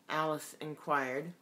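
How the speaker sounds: ordinary delivery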